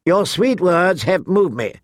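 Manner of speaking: gallant. A narrator's reading. King Joffrey answers Sansa's plea, mildly gallant. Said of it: "he said gallantly".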